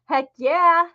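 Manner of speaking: ordinary talk, nothing marked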